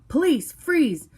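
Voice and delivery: high, loud, slow police shout. A narrator's reading. Officer shouting at an armed suspect, loud slow shout. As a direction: yell it out slowly, drawing each word. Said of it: "I heard Jacob shout".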